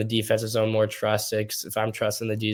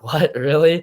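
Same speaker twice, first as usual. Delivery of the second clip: retold disbelief, jumping higher and louder with a laugh; a laughing, higher, louder what-really of disbelief; surprised what really; 6 semitones higher, 7 dB louder, slower than usual. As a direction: replay the shock of hearing the news, half laughing. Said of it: "And I'm like".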